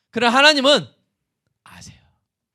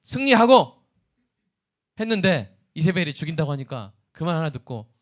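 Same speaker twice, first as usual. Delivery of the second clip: ordinary delivery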